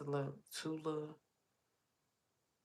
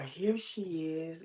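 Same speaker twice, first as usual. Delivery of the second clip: slow, louder mimicked greeting; drawn-out greeting; 3 semitones higher, 6 dB louder, slower than usual. Pantry lady announcing her arrival, drawn-out.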